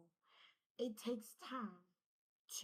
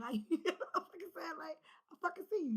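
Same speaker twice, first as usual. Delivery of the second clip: laughing; 8 semitones higher, 7 dB louder, faster than usual. Real, not acted. Laughing at the card appearing.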